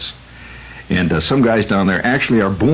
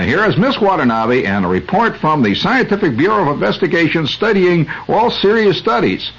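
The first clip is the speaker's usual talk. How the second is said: higher, hammed-up news anchor; mock announcer; 8 semitones higher than usual. Radio host handing over to Jane as a hammed-up anchor. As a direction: keep the corny radio host going.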